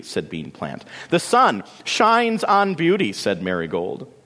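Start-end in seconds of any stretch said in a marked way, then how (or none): 1.1-3.1 high, grand self-admiring retort; indignant vanity. A narrator's reading. Marigold rejects Lily's news in turn, vainly indignant. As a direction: proclaim it pitched up.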